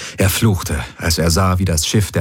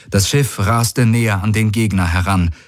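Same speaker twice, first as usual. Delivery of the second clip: ordinary delivery, nothing marked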